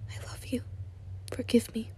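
breathy whisper, barely voiced. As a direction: whisper it close in the dark. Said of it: "he whispered".

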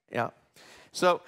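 ordinary talk, nothing marked

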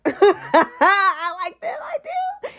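words drawn out through laughter; high, squeaky end as laughter takes over; real laughing. Real, not acted. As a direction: say it while cracking up. Cracks up while admitting liking them.

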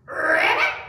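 loud rolled ribbit, rising; pig's frog croak. A narrator's reading. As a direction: croak it with a long trilled R. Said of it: "practicing his".

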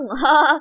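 short scornful chuckle; guard's mocking laugh. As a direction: laugh in the kid's face. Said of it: "เขาขำใส่หน้าฉัน".

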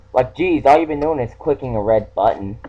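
ordinary talk

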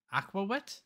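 baffled "Aqua Wit?" rising at the end; puzzled echo. Shown as a demonstration. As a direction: repeat the name back in disbelief. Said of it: "Aqua Wit for dummies".

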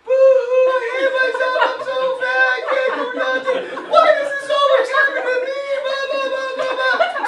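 high, loud boo-hoo fake sobbing; mock crying whine. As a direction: ham up a crybaby blubbering through laughs. Mocking someone crying over insults.

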